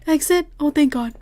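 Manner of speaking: loud, high burst of relief; relieved outburst. Real, not acted. Player spots the exit in a horror game, relieved. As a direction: call it out, hugely relieved.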